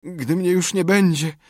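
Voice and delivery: high, mournful farewell; deathbed lament. A narrator's reading. Tomek pretending to die before Sid, lamenting.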